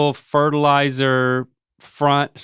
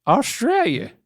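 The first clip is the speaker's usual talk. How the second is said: Australia pitched higher in a mock Australian accent, OSS-tray-lya; 7 semitones higher, slower than usual. Son hamming an Aussie accent for dad. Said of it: "do that accent they talk like".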